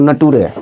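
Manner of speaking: नटूरे read as spelt, na-too-ray; comic misreading नटूरे. Shown as a demonstration. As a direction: sound out "nature" letter by letter as नटूरे. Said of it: "इसको पढ़ता था लोग नटूरे". (नटूरे, अक्षर दर अक्षर गलत उच्चारण)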